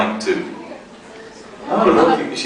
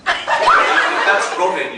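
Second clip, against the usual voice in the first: mock surprise; 6 semitones higher, much slower than usual. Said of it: "Robin's got a really deep voice".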